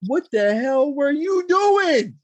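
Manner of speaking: high, slow yelling in disbelief; shocked shout. Retold or imitated. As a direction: shout it up high, half crying, half laughing. Confronting his wife after slap.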